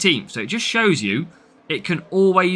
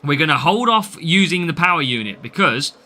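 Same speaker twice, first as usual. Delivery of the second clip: ordinary delivery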